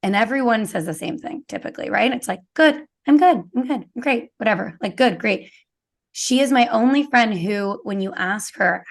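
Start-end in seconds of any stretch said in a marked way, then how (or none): 2.5-5.5 chirpy, higher, louder stock answer; breezy brush-off, on autopilot; autopilot reply. Retold or imitated. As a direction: rattle off the canned good-great. Mocking everyone's autopilot answer.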